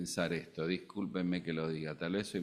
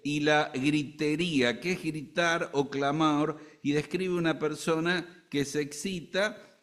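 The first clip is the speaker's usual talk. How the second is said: higher and louder, driving the sermon forward; preaching at full force; 8 semitones higher, 6 dB louder than usual. Acted. Pastor lists the sins to the congregation, preaching at full force. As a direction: lift the pulpit voice and push each point.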